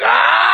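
high-pitched yell of remembered agony; acted pain scream. Patient retelling his toe being poked, high mock scream. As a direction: act out a sudden jolt of pain. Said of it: "I remember feeling like".

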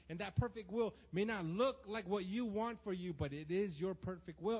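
loud, driving preacher voice; forceful preaching. Acted. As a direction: preach it hard to the congregation. Preacher quoting God at full force.

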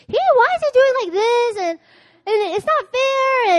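high, whining complaint to God; whiny complaint. Retold or imitated. Wife complaining to God in bed, whining. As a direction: sulk like a wronged child.

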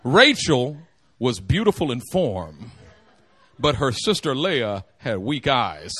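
slow and low, setting the two side by side; slow deliberate contrast. Shown as a demonstration. Preacher contrasting the two sisters, slow and pointed. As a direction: lay out each half slowly. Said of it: "look at this comparison".

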